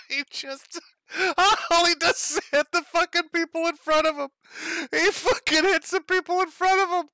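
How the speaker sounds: very high, loud voice cracking with laughter; high shrieking giggle over the clip; squealing laugh. Real, not acted. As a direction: narrate it while losing it laughing.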